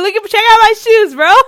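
high, loud, rushed buddy talk; over-eager mock friendliness; fake chill. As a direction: show off to a bro. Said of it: "so chill".

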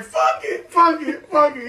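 hammed-up retold yelling; mock shout. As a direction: yell it like a rapper fed up. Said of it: "he was like".

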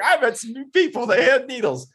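high, chipper, oblivious pup; a happy dog bragging about her day; cheerful dog voice. Retold or imitated. Owner voicing her cheery dog after shots, high and chipper. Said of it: "She's like".

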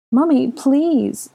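put-on high, slow little boy begging; pleading child. Little boy pleading with Hermione, high and slow. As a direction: beg like a small child, drawn out. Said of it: "Aurelian pleaded".

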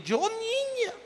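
hammed-up high-pitched complaint; mock one-upping complainer. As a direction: leap up into a high, drawn-out voice. Imita a quien compite con enfermedades. (queja aguda, exagerada y burlona)

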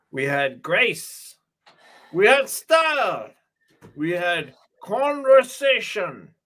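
slow, hammed-up villain lament; mock-grand speech with high shrill peaks; overacted villain mourning lost style; Merovingian rant. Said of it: "So what does he say?".

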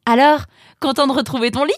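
put-on high, quick girl's voice; perky sister welcoming her brother; teasing sister's question. Sister greets her returned brother.

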